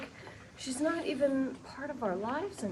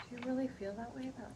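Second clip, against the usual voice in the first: hurt hush; 2 semitones lower, 11 dB quieter, faster than usual. Acted. Lily hurt by Robin.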